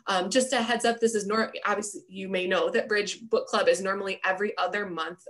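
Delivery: ordinary delivery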